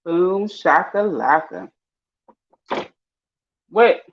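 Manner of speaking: ordinary delivery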